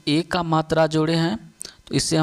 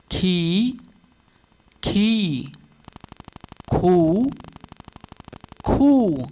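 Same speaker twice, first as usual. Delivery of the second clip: drawn-out classroom chant, each syllable lifted; rhythmic teacher's drill; sing-song recitation; much slower than usual. Shown as a demonstration. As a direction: chant the syllables slowly for pupils to copy.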